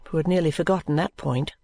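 plain reading voice

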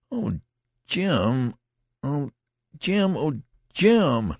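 high, wailing cries of grief; lamenting. A narrator's reading. Youth grieving over his wounded friend, lamenting. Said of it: "The youth still lamented".

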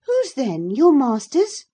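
put-on high-pitched, loud girl's question; inquisitive girl. Cathy asks the farm lad about his master, high and insistent.

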